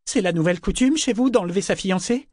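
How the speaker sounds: high, indignant question; indignant challenge. A narrator's reading. Kefria's outraged challenge to Rain. (question indignée)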